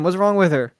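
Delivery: ordinary talk, nothing marked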